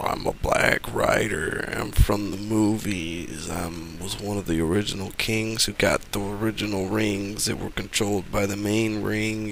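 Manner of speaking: a lightly hammed-up villain intoning his backstory.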